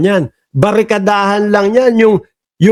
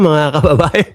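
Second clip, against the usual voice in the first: laughing; 4 semitones lower than usual. Real, not acted.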